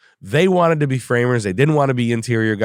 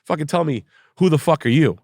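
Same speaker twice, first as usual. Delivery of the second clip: mock angry demand; 6 semitones higher than usual. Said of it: "He's like".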